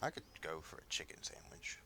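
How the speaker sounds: whispered aside; breathy whispered craving. Real, not acted. Quiet aside about sandwich.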